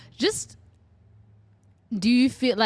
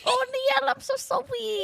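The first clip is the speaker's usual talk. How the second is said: put-on high whiny baby talk. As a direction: coo it like a grown man babying. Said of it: "put a baby voice on".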